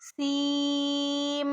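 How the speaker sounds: सीम held very slowly, ending on a humming म; nasal ending demo. Shown as a demonstration. Teacher correcting the ending in a slow recitation. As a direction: stretch सीम and close on a nasal म. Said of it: "ऐसे, ठीक है".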